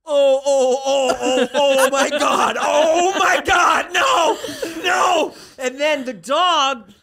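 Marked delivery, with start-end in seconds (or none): 0.1-5.3 high, loud drawn-out wailing; slow, escalating cartoonish dread; mock panic. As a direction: cry out in hammed-up horror. Acts panic at screaming man.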